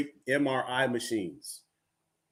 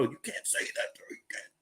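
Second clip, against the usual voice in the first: whispered mock telling-off; very soft, breath-only reprimand; hushed scolding; 12 dB quieter than usual. Store owner scolds parrot in joke.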